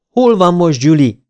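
loud, high, hard demand; steely demand. A narrator's reading. Wyatt demanding Julie's whereabouts from the woman, steely. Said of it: "kérdezte acélos tekintettel Wyatt".

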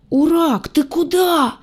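high, trembling frightened little voice; hoarse trembling drawl. A narrator's reading. Redhead calls after the fleeing crocodile, trembling drawl. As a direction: stretch it out hoarsely, quavering. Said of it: "сипло протянула рыжая дрожащим голоском".

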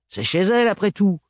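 jeering mechanic, mildly grating. Acted. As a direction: needle him with a scraping tone. Narrator voices Gaëlle.

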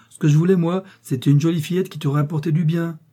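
scolding. A narrator's reading. Mother wanting a girl with money, scolding. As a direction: press the grievance crossly. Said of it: "gronda la mère".

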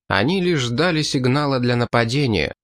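plain reading voice

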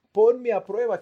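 "Ponme a prueba" cried out high and loud; loud plea. Acted. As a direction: fling the plea out at full voice. Preacher quotes David's bold plea. (clamor fuerte y agudo)

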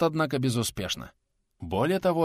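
plain reading voice

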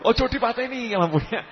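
high-pitched mock panic; whiny, fearful protest, played for comedy; mock scared plea. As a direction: plead in pretend fright, voice jumping up high. Acting a frightened plea about the lamp.

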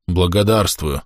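ordinary delivery

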